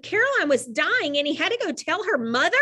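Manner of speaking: usual speaking voice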